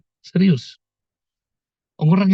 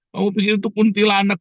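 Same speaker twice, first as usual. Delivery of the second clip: ordinary delivery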